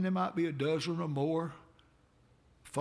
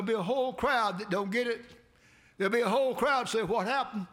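high, loud, fast preacher's cry; preaching at full force; 6 semitones higher, 4 dB louder than usual. Acted. Preacher warns the congregation, high and loud. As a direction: warn them with a ringing shout.

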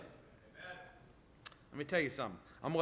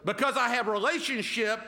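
preaching; 5 semitones higher than usual. Acted. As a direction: preach it out to the congregation. Pastor preaching at full force.

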